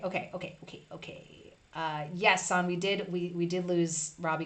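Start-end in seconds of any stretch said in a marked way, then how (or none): 0.0-1.5 whispered okays under breath; murmured okays. Real, not acted. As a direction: whisper to yourself.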